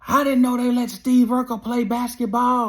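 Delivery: loud mocking heckle; mock heckler yell. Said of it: "He yells out his window".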